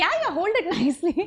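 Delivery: high, giggling request through a laugh; laughing ask. Retold or imitated. Actress urging co-star on set, laughing through it. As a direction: laugh while urging him again.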